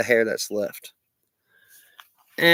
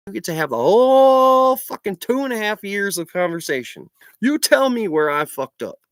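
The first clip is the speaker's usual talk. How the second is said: loud, furious and higher, spitting it out; angry rant; 7 semitones higher, 5 dB louder than usual. Real, not acted. Ranting at an online critic, furious and loud. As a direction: tell them off at full volume.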